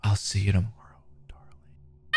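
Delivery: breathy threatening whisper right at the ear; creepy whisper. A narrator's reading. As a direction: whisper it close, like a stalker leaning in. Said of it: "A familiar and sickening voice whisper in my ear".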